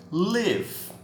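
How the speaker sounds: live said as "lev", short i; pron of live. Shown as a demonstration. Teacher to class, showing the verb: live as "liv". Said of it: "Olha a transcrição fonética".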